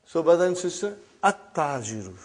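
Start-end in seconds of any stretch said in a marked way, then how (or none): 1.2-2.1 "at-tajiru" lower, with doubled t and silent lam; shadda on t. Shown as a demonstration. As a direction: demonstrate "at-tajiru", holding the t. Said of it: "this shadda is given".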